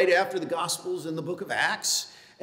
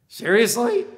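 high, loud incredulous seriously; exasperated sarcastic question; 6 semitones higher, 7 dB louder than usual. Shown as a demonstration. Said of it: "a sarcastic tone".

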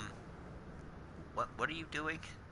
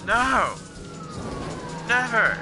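shouting no; 9 semitones higher than usual. Real, not acted. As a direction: yell it out at the screen. Shouting at the game.

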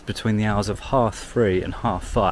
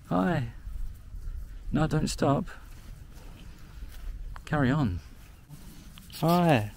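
high, sing-song hello to the sheep; high pet-talk voice; cooing at animals; 7 semitones higher, 4 dB quieter than usual. Real, not acted. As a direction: coo at the animals like pets. Greeting the sheep in the field.

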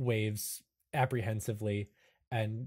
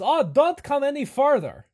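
put-on high, loud old librarian pleading; a high, cracked warning from a hunched keeper; 13 semitones higher, 10 dB louder than usual. Bandaged librarian warns the party back, high and loud.

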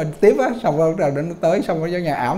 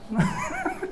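sudden high-pitched laugh breaking out; short amused high laugh; burst of high laughter; 10 dB quieter than usual. Real, not acted. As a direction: let a high laugh escape at the punchline. Laughs at the retold exchange.

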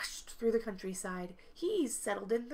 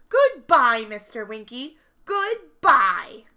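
a grouchy neighbour who can't take the noise; loud exasperated complaint; 4 semitones higher, 14 dB louder than usual. Acted. Glum complains about the noise.

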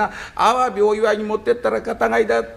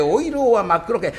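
ordinary delivery, nothing marked

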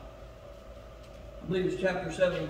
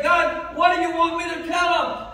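a higher, louder plea to God; praying to God; 8 semitones higher, 7 dB louder than usual. Retold or imitated. Preacher retelling his weekly prayer, earnest raised plea. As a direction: lift the question up to heaven. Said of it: "I try to say".

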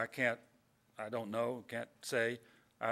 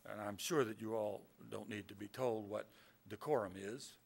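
ordinary delivery

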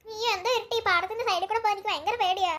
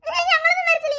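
defiant refusal; 7 semitones higher, 5 dB louder than usual. Acted. Girls refuse the kidnapper.

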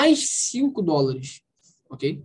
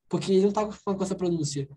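ordinary delivery

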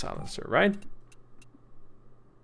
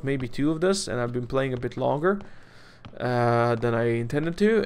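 ordinary talk

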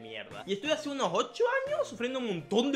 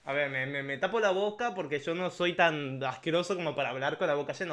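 mouth full; 5 semitones lower, 6 dB quieter than usual. Real, not acted. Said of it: "estoy hablando con la boca llena".

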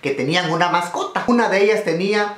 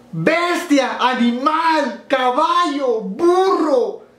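mock scolding girls; 10 semitones higher, much slower than usual. Girls shouting at the peeing drunk, high scolding. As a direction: shriek the insults like shocked girls.

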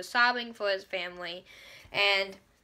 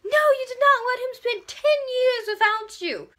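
much higher, loud protest; outraged cry; 10 semitones higher, 5 dB louder than usual. Retold or imitated. Reader yelling at the characters over lost years. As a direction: yell at the book. Outraged at the ten year gap.

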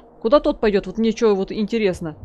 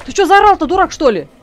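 indignant shout; 5 semitones higher, 6 dB louder than usual. Real, not acted. NPC suddenly yells at her.

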